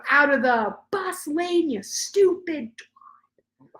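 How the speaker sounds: high, slow, mocking imitation of an angry driver; mock road rage. Mother retells the angry bus driver, mocking, high and slow. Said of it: "he was like".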